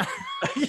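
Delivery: squeaky laugh; a sudden high-pitched laugh; high burst of laughter. Real, not acted. Laughs at own story.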